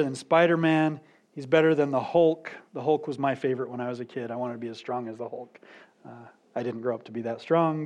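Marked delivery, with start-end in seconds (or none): 2.7-7.8 soft, fast, lower throwaway aside; quick aside. Real, not acted. Preacher's joking aside about wanting to be the Hulk, muttered. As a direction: toss it off quickly under your breath. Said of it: "aside about childhood".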